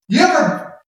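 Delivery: ordinary delivery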